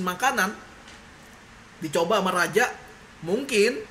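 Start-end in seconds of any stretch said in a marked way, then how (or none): none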